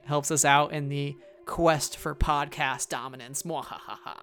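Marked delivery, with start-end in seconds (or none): 3.4-4.2 soft evil cackle; a hushed, hammy villain laugh; sneering panto villain. Pretend. Mock villain plan for podcast dominance.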